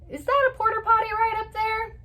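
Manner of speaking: high-pitched imitation of a kid spotting something; mock-innocent question. Child pointing something out from the car, mock-innocent. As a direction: pitch it way up, playfully wondering. Replays the brother's teasing question.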